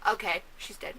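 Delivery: soft, low, emotionless acceptance; imitating a mother who barely reacts; unbothered reaction. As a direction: shrug it off quietly. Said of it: "she's just like".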